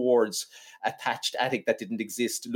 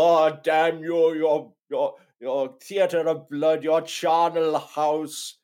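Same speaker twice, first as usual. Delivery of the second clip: slow, higher-pitched theatrical lament; milk every word like a hammy actor; hammed-up, drawn-out cursing; overwrought surgeon; 4 semitones higher, much slower than usual. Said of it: "that overwrought scene where he's go".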